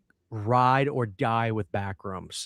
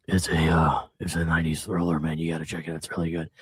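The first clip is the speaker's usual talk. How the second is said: low hushed buddy voice; a much lower, half-whispered bro voice; imitating his friend, low and hushed; impression of Cody; 10 semitones lower than usual. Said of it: "I'll do my best impression".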